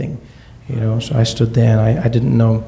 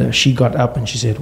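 normal talking voice

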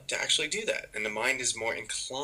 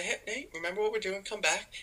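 gentle coaxing; 10 semitones higher than usual. Retold or imitated. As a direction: call the puppy back kindly. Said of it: "And we say".

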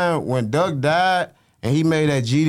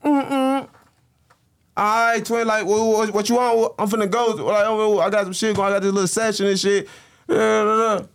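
high, breezy brush-off; mock Ruger excuse; 8 semitones higher than usual. Imitating the friend driving off, high and dismissive. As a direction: play the shifty friend slipping away.

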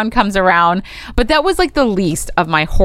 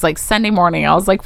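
usual speaking voice

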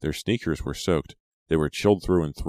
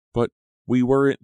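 higher, louder protest; 9 semitones higher, 8 dB louder than usual. A narrator's reading. Della objects to Abner's scolding, protesting. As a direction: object with a raised voice. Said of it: "Della protested".

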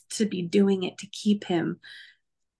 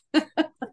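higher short laugh; light laugh; happy laugh; 9 semitones higher than usual. Real, not acted. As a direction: laugh with relief. Laughing after leaving.

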